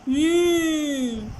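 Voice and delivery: teacher hums the N; N sound. Shown as a demonstration. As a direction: hum the N as a sound. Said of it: "the sound of N is".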